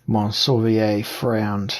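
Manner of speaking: ordinary delivery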